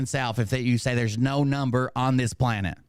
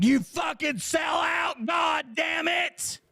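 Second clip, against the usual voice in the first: hammed-up screaming rant, much higher; mock furious yell; 12 semitones higher, slower than usual. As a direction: play a betrayed streamer screaming at a deserter. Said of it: "You think I'd be like".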